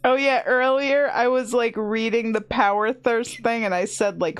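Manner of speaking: ordinary delivery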